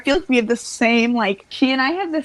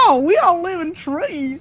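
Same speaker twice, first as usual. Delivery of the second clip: higher, whooping put-on Southern twang; 6 semitones higher than usual. Podcaster playing up life down South, mock Southern hillbilly whoop.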